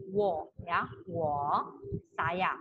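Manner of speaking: wo said low and round; Mandarin wo. Shown as a demonstration. Teacher models wo meaning I. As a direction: model wo for the class. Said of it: "penulisannya W O".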